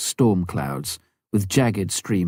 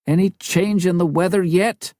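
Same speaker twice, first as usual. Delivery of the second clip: higher, louder urgent radio question; anxious pilot on the radio; 11 semitones higher, 4 dB louder than usual. A narrator's reading. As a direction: press for news over the radio. Tom asks on radio.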